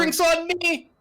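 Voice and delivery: high, loud tavern shout; big, high-pitched boisterous call. Acted. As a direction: holler it to the whole bar. Player's character calls out to tavern.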